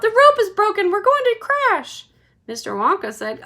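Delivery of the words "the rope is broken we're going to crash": very high, loud panicked cry. A narrator's reading. Missus TV fearing the crash, panicked cry. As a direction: scream it in terror. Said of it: "Missus T V cried out".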